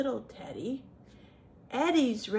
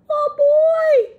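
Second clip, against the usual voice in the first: very high, very loud alarmed yell; startled bear's drawn-out shout; 17 dB louder, much slower than usual. A narrator's reading. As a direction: bellow high in storybook panic. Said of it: "yelled the bear".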